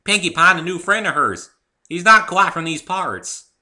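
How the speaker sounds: hearty farm mare welcoming a friend; Applejack's voice. Acted. Applejack answers.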